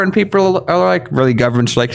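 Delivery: ordinary talk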